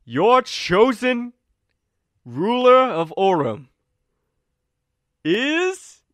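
booming, slow, high herald voice; grand announcement. Acted. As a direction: draw out the reveal like a herald. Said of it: "and he's like".

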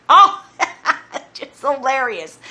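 scornfully amused; derisive laughter. Acted. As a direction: laugh through the words, slowing down.

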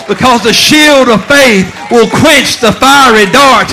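loud, high preacher's cry with a laugh; the verse thundered from the pulpit; preached at full force. Acted. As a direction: shout the promise out, laughing with joy.

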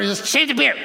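high-pitched, mildly exasperated blurt; exasperated outburst. Acted. Preacher reacting to a man's long beard, exasperated outburst. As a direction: blurt it out as if it were obvious.

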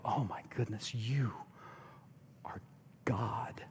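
a whispered gasp of recognition; awed whisper. Retold or imitated. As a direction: breathe it out in stunned wonder. Everyone seeing Jesus, voiced awe.

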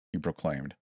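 plain reading voice

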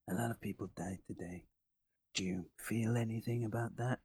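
quiet, quick antihero voice; Snake Plissken impression. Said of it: "do your best Snake Plissken impression".

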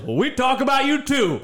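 retold comeback with a high swing; heckler in the audience. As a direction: lightly imitate an audience member answering back. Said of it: "this guy in the audience goes".

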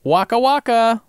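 high, loud goofy voice; hammed-up silly exclamation; comic catchphrase voice. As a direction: pitch it way up like a cartoon gag. Comic catchphrase joining mock amazement.

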